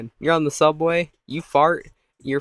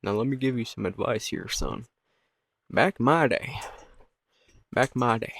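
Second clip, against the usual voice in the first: lightly voiced old man; a hint of grandfatherly advice-giving; 4 dB quieter than usual.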